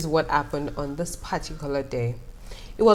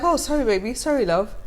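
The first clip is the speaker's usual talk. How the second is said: higher soothing coo; 7 semitones higher than usual. Retold or imitated. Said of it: "And I'm like".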